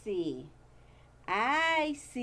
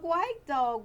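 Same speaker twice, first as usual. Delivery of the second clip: plain reading voice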